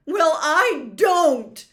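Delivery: loud, high, slow outburst; loud defiance. Acted. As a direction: shout it back defiantly. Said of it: "as loudly as before".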